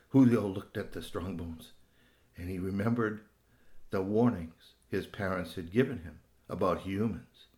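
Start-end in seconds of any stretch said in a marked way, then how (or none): none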